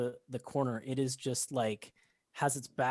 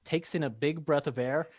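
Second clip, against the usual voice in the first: usual speaking voice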